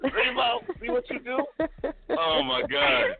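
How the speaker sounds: high, laughing tease; laughing teasing. Real, not acted. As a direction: tease her through laughter. Teasing co-host while laughing.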